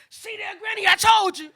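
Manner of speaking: high-pitched protest rising to loud; indignant outcry. Retold or imitated. Granddaughter proves her point to Granny, high indignant outcry.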